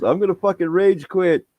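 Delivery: high mock whine of outrage; imitated fed-up gamer. Dad imitating his son's exasperated teammate, high protest.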